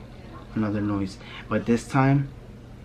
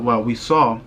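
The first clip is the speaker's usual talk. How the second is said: ordinary delivery, nothing marked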